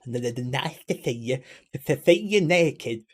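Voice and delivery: slow, badly done TV host catchphrase voice; horrible Bruce Forsyth. Streamer badly imitating a TV host's catchphrase, put-on showman voice. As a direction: draw out the catchphrase in a cheesy put-on voice. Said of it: "that's a horrible impression".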